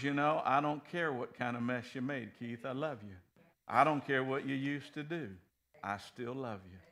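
soft, low, fading tenderness; father comforting Keith, softly; tender love. Retold or imitated. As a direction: say it like a loving father. God speaks tenderly to Keith.

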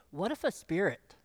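usual speaking voice

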